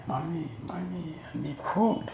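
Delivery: small, high, soft mock whimper; crying child. A hungry boy begs his mother, mock crying. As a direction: imitate a hungry child pleading softly. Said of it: "Yes, he'll cry".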